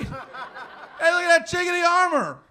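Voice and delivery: loud, high jeer across a fair; hammed-up holler; mock heckling shout. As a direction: yell it like a pointing onlooker. Acted onlooker at Ren Fair.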